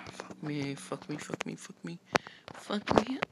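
soft, sighing curses; weary sighing. Real, not acted. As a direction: sigh it out, worn down. Sighing in exhaustion.

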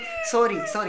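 very high, squeaky pleading sorry. Real, not acted. Big brother begging sulking Prateek to forgive him, squeaky high plea. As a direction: beg in a tiny high voice.

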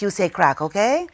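ordinary delivery, nothing marked

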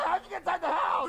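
high shouting puppet voices; high shouted exchange. Acted. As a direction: yell at the intruder. Confrontation with burglar.

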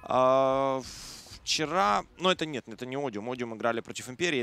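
normal talking voice

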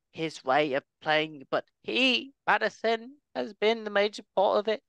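mock Australian pundit accent, high and bright-toned. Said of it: "Australian accent was hundred percent on".

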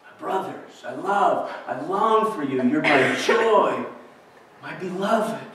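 quiet tender voicing of Paul's love; tender, soft. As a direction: say it gently like a father to his children. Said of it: "heart of tender affection".

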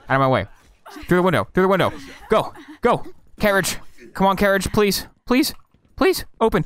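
high frantic pleading; panicked gamer begging it to open; raging gamer. Real, not acted. Escaping enemies, begging the carriage.

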